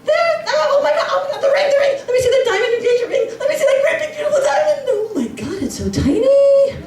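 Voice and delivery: squealing high, laughing mock excitement; gushing over ring. Guests at the shower fawning over the ring, high squeal. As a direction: squeal then let it drop in disappointment.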